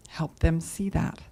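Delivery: normal talking voice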